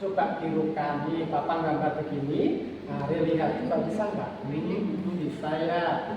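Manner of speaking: put-on slow, soft coaching of the child; papa quietly encouraging Re to try; calm parent demo. Shown as a demonstration. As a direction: patiently invite the child to copy. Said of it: "Setelah tiga kali baru ngomong".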